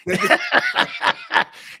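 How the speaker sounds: high, loud burst of laughter; loud cackle. Real, not acted. Host cracking up at his Rock story, loud high cackle. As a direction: cackle at his own joke.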